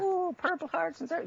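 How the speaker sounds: very high-pitched mock leprechaun voice; cartoon leprechaun. Mimicking the Lucky Charms guy, in cartoon leprechaun voice. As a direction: squeal it like a cereal-ad leprechaun. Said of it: "that little leprechaun that in America".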